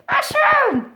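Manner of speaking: high, loud exclaimed word; eager loud mushroom; excited answer. Real, not acted. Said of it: "What is it?".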